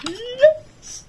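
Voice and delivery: very high squeaked yes, then a whispered one; squeal, then catch yourself and whisper; holding back a shout of joy; stifled squeal of joy. Real, not acted. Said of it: "Je ne crie pas".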